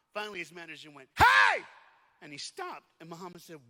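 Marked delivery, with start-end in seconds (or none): 1.2-1.6 sudden high, full-force yell; explosive attention-getting holler. Retold or imitated. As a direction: yell to grab a distracted fighter's attention. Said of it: "Why are you yelling?".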